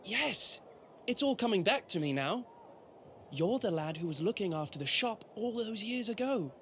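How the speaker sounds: light up with sudden recognition; delighted recognition. Acted. Prince recognising the shop lad from years ago, delighted.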